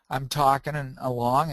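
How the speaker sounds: ordinary talk, nothing marked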